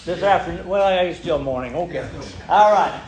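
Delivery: ordinary talk, nothing marked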